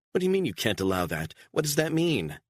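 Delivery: fast, rising to a high demand; incredulous anger. Acted. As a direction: throw his words back at him. Beatrice challenging Stone's refusal.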